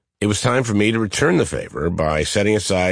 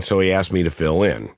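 ordinary delivery, nothing marked

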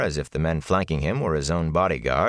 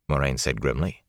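ordinary delivery

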